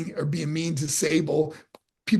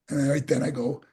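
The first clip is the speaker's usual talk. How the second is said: ordinary talk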